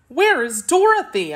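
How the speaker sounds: high, mildly anxious question. A narrator's reading. Boy asking after his friend, high anxious inquiry. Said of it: "inquired Zeb anxiously".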